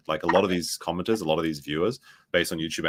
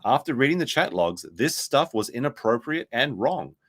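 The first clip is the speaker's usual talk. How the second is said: higher-pitched, emphatic reading aloud; statement read-out; 5 semitones higher than usual. Acted. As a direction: read it out like a declaration. Reads a posted statement aloud.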